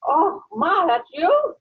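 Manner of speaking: high, incredulous question; imitating surprised daughter. Mother retells daughter not recognising her voice, high surprised mimicry. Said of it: "My daughter said".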